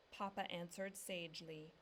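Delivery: usual speaking voice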